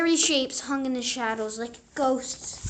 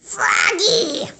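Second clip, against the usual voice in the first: high, loud scolding yell; 9 semitones higher, 10 dB louder than usual. A narrator's reading. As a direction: yell his name across the dark house. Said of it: "yelled his mother".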